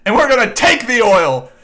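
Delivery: mocking Trump's rally boast, loud and brash; Trump impression. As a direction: bellow it like a rally speech. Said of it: "he goes".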